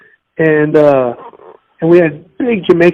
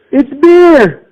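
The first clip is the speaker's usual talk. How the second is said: excited; 10 semitones higher, 6 dB louder than usual. Real, not acted. Excited exclamation about Red Stripe.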